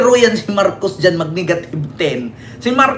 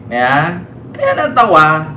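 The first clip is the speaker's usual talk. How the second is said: ordinary delivery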